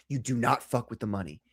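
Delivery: low, hushed warning; grave warning. Real, not acted. Host warning whoever messes with people's money, grave and hushed. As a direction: drop low and soft, dead serious.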